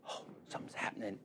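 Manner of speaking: a hushed, awed whisper; a patient marvelling softly as his leg wakes. Retold or imitated. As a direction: breathe it out in wonder. Patient's hushed awe, retold.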